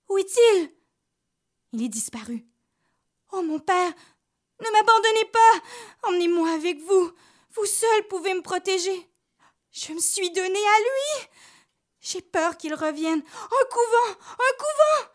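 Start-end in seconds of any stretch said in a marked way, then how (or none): 0.1-0.7 higher, louder frightened cry; panicked repeat. Acted. Rose fearing the devil's return, high frightened cry. 3.3-15.1 put-on high, pleading, frightened girl; terrified pleading. Rose begging the priest to take her to a convent, high terrified plea.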